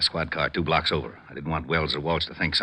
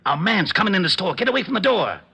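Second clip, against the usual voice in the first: higher and louder, pressing; urgent warning; 12 semitones higher, 4 dB louder than usual. Acted. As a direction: hurry him away from the door. Urgent warning as suspect approaches.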